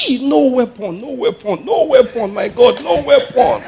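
high, repeated cry of alarm; hammed-up panic, chanting it as protection; panicked exclamation. Preacher acts out a shocked witness's new swear word, panicky cries. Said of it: "It's a good swear word".

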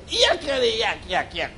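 high, rapid chattering yak-yak noise; evil spirit's yakking. Retold or imitated. Preacher mimicking the evil spirit drowning out the Lord, high yakking. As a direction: imitate a demon's noisy jabber. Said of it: "That's how he said it".

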